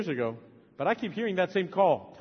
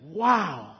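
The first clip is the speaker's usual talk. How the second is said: ordinary delivery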